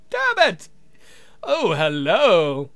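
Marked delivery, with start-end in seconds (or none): 0.1-0.7 high-pitched frustrated curse; a high comic curse. Acted. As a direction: squeak out the curse. Vampire hands cramping.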